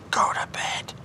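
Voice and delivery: whispered, high, soft command to the cat; whispered order. Real, not acted. Whispering to the cat to go to bed.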